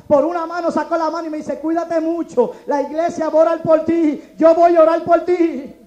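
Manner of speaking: loud preached storytelling; fiery testimony. Real, not acted. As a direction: preach the story with full force.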